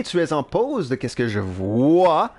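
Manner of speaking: usual speaking voice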